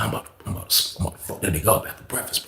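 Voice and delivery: whisper. Retold or imitated. Friend plotting quietly in jail.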